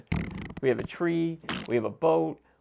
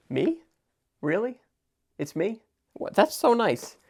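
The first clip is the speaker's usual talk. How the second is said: mock surprised; 9 semitones higher, 3 dB louder than usual. Mock flattered by imagined viewer.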